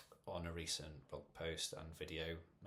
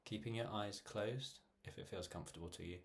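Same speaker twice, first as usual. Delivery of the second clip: measured meditation voice with long pauses; guided meditation. Real, not acted. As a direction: guide listeners gently through breathing, phrase by phrase. Leads a guided breathing meditation.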